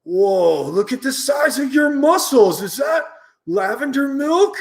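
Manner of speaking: high, shouted amazement; Bernard. Acted. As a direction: gasp at the muscles. Bully amazed at muscles.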